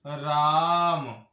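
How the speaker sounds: राम said with the aa held very long and slow; राम as a pluta vowel, aa drawn out; long drawn-out Raam. Shown as a demonstration. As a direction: stretch राम over three beats. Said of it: "तो इसका उच्चारण हो जाएगा".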